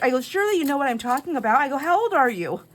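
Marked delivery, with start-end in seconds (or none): 0.2-1.6 high, loud, fast teasing insistence; mock disbelief. Shopper teasing the young clerk about edibles, mock disbelief. 1.8-2.6 high, loud incredulous question; retold teasing demand, pitched up; mock disbelief. Shopper teasing clerk over his age, incredulous.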